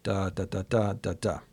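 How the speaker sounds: "da" syllables as long, short, short, long, short, long; measured "da, da" beat with quick shorts; rhythm demonstration. Shown as a demonstration. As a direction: sound out choriamb plus iamb on "da". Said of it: "comes choriamb plus iamb".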